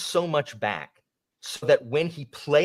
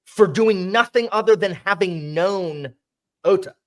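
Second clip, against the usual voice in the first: worked-up, high and loud; indignant; 9 semitones higher, 8 dB louder than usual. Real, not acted. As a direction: make the outraged case, voice raised and high. Indignant argument about character's fate.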